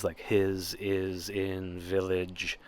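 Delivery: "his is in village" with each short i stretched out slowly; drawn-out vowel sounds. Shown as a demonstration. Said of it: "the I, a lot of is".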